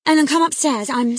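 high, loud urgent call; panicked summons. A narrator's reading. Girl panicking, summons nurse.

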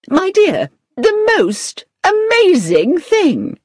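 very high, drawn-out gushing; excited. A narrator's reading. Agency manager telephones Victoria with news, excited. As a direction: burst out with amazing news, stretching it slowly. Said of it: "who said excitedly".

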